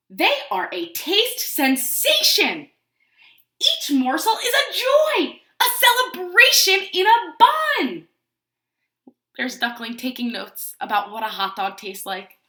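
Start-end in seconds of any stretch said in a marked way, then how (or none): 0.1-8.1 slow, loud, rising ecstatic praise; grand high-pitched hot dog rhapsody; rapturous pigeon. A narrator's reading. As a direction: proclaim each word with soaring delight. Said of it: "Pigeon seems to love hot dogs".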